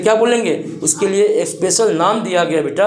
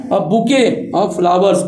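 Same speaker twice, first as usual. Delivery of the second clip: slow dictation; much slower than usual. Shown as a demonstration. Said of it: "bouquet नहीं पढ़ना".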